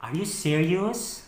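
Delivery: soft, slow rising question; quiet disbelief. Acted. Partner checking the breakup is real, in quiet disbelief.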